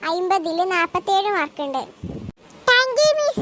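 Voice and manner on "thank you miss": high, sweet schoolgirl thanking teacher; little girl voice. Acted. Schoolgirl thanking the teacher in a high child voice. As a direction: chirp it like a small pupil.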